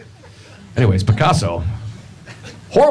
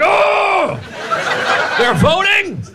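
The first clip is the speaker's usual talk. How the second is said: high, shocked mock outrage; cartoonish squawk of disbelief; indignant Picasso; 10 semitones higher, much slower than usual. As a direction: play the appalled misogynist hearing news.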